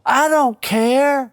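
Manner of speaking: high, far-off drill-sergeant bark; Sarge yelling. Retold or imitated. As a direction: bark it like a furious wrestling coach. Sarge refusing.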